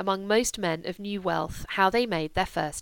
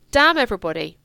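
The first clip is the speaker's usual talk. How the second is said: louder, sharp burst; defiant outburst; 8 dB louder than usual. Acted. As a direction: fling it at her. Julia's outburst at mother.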